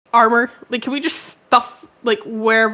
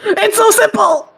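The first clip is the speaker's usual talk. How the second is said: exasperated yelling; 7 semitones higher than usual. Acted. Demanding armor removal, exasperated.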